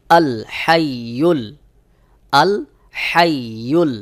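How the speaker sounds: হাইয়ুল with হা pressed from mid-throat, slowly; correct ha. Shown as a demonstration. Teacher showing the correct throat ha. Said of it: "গলার মধ্যখান থেকে একটু চাপ দিয়ে".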